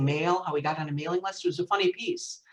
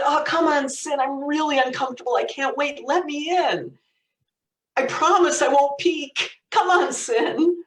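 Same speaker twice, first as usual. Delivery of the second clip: high-pitched, wheedling begging; desperate pleading; 9 semitones higher, 4 dB louder than usual. Retold or imitated. Brother begging his sister for the bathroom, desperate pleading. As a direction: imitate a brother pleading through the door.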